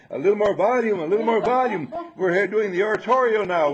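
loud, high calls for volume; choir director. Acted. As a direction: rouse the singers like a choir director. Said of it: "like a choir director saying".

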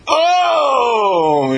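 high, loud whoop of joy; joyful shout. Acted. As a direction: shout it like he just got a yes. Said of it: "He shouts for joy".

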